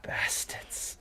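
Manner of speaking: breathy whispered bastards; whispered curse. Real, not acted. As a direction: hiss it under your breath. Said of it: "muttered under breath".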